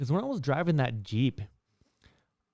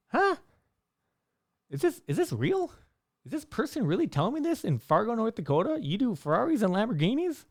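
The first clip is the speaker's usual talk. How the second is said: much higher, incredulous questioning; can't believe what you're hearing; disbelief; 10 semitones higher than usual. Retold or imitated. Narrator retelling his shock at the rich guy's boast, high incredulous voice.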